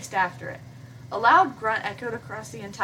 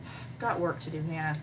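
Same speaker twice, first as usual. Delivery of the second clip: curt dismissal; 6 semitones lower, 7 dB quieter than usual. Acted. PBG sends Hannah away, low and curt. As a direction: lower and softer, a curt sullen boy.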